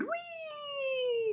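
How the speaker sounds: high breathy whee; fly with a soft high whee; airy high whee; whispered whee. Acted. Marvin shot from cannon.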